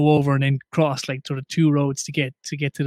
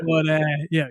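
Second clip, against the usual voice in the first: normal talking voice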